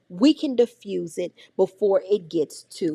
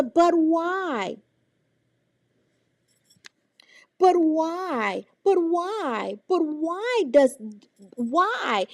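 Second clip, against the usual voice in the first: put-on higher, repeated "But why?" like a curious kid; whiny child; 5 semitones higher, much slower than usual. Teacher mimicking a child's endless questions. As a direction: nag with endless whys. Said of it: "My favorite thing is the".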